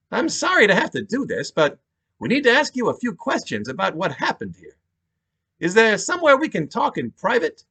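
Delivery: steady New York detective asking questions; New York accent. Acted. Detective to the widow, in a New York accent. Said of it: "He had a thick New York accent".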